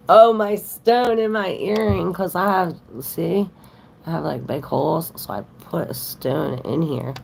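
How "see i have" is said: ordinary talk, nothing marked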